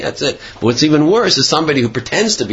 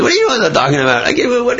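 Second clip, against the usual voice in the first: fast, much higher, disbelieving; incredulous outrage; 13 semitones higher, much faster than usual. Acted. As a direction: fire it back in shock. Cheated man confronts the hypocrite.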